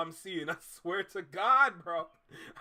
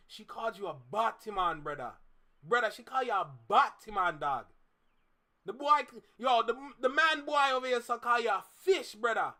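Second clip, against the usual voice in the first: a hint of Jamaican patois, teasing; light Jamaican patois, ribbing a friend; Caribbean patois; 2 semitones higher than usual. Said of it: "It's called patois. That's all that I'm speaking".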